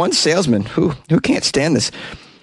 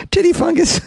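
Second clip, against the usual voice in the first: squeaky high laughing repeat. Real, not acted. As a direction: giggle the words out very high. Laughing at his own joke.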